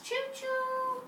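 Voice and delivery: playful high-pitched Choo choo; high train call. Shown as a demonstration.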